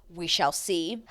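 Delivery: normal talking voice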